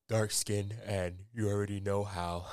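talking through a yawn; words stretched by a real yawn. Real, not acted. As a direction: let a yawn creep into the words. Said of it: "I was yawning".

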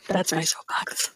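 breathy whispered aside. Real, not acted. She ends her rant to her co-host with a whispered aside. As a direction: whisper it like a confession.

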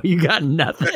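ordinary delivery

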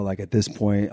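ordinary delivery

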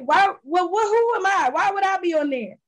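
high-pitched imitation of puzzled modesty; mock disbelief. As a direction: play her baffled "who, me?". Guest's disbelief at the invitation, acted.

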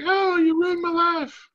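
high, loud, mock-crying teen wail; teen tantrum. Host teasing her as a sulking thirteen-year-old, mock wailing. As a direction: wail like a teenager whose birthday party was canceled. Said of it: "thirteen-year-old girl who had her birthday party canceled".

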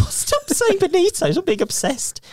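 high laughing mock scold; laughing protest. Real, not acted. Teasing co-host about Benito.

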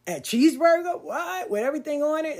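high, loud gush over a cheeseburger. Real, not acted. Teen mom gushing to listeners about her favorite meal, excited. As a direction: sell it like your all-time favorite meal.

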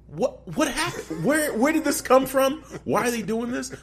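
high, stammering disbelief; bewildered. Retold or imitated. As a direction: squeak out baffled questions. Retelling shock at ad.

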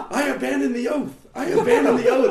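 high laughing shout; mock yelling. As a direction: yell it like a crazed larper. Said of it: "guys yelling".

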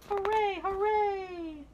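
high, loud hooray; cheering. Acted. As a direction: shout it like jubilant kids. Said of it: "the children cried".

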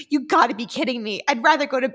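ordinary delivery

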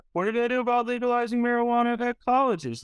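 high, quick mocking teen voice; mock high schooler. Students quizzing the candidate, fast mocking high teen voice. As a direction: rattle it off like a silly student. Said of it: "all the standard high school questions".

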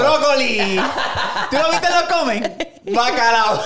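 high, loud and laughing; gleeful high-pitched shout; shouted punchline. Real, not acted. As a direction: yell it out through laughter. Yelling punchline amid laughter.

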